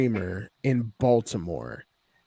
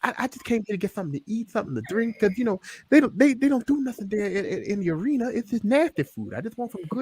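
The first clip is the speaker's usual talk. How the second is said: Dusty Rhodes impression; 9 semitones higher, faster than usual. Dusty explaining why he came to Hooters, impersonated. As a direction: complain about arena food like a big-talking wrestler. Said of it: "that's a great fucking impression".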